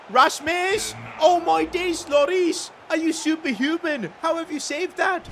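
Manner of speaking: high, loud disbelieving yells; stunned shout. Real, not acted. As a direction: yell in disbelief at the save. Keeper saves a big chance.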